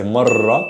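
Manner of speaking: "marra" with a long trilled R; strong R. Shown as a demonstration. Arabic teacher gives another example, strong trilled R. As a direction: roll the double R of "marra". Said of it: "that's a strong R".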